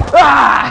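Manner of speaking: high loud boo-like ah; jump-scare ah. Retold or imitated. Jumping out at Dustin with a loud high ah. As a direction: shout ah to scare him. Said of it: "I was like".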